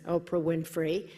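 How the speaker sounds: ordinary delivery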